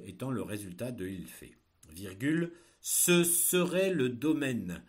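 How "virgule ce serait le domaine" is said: slow, loud dictation; dictating. Shown as a demonstration. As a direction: read it out for pupils to write. Teacher dictating a text.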